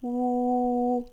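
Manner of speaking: "و" as a long, loud, steady oo; stretched-out oo sound for "و"; long vowel. Shown as a demonstration. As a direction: sustain "و" as oooo on a level note. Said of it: "أما صوت الواو فهو طويل".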